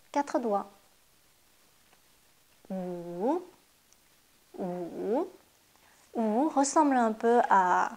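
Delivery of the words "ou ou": « Ou » grave qui descend puis remonte; « Ou » plongeant dans le grave; wu troisième ton. Shown as a demonstration. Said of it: "le troisième ton". (wu au troisième ton)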